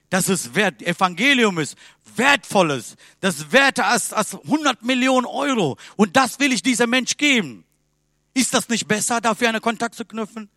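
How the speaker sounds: loud, high, fervent preaching of his answer; full-force preaching. Acted. Preacher to congregation, answering a doubter, loud fervent preaching. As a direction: proclaim it like a pulpit climax.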